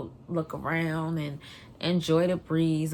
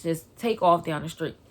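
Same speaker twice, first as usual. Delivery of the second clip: ordinary talk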